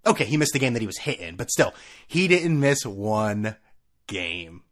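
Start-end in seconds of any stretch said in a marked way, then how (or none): 2.1-4.6 slow, pointed delivery with a hushed last word; emphatic. Real, not acted. Repeats the point.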